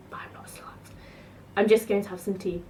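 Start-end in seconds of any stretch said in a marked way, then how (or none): 0.1-0.9 whispered aside. Real, not acted. Cheeky aside to camera.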